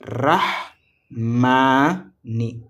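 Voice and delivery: "Ar, rah, ma, ni" in separate beats; teacher splitting the word into four syllables; each syllable set apart by a pause. Shown as a demonstration. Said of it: "Combien de syllabes?".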